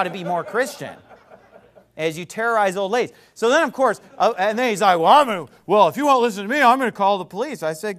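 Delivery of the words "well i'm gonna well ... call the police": louder imitated threat; mock threat. Preacher imitating old guy threatening to call police, louder.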